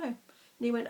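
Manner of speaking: normal talking voice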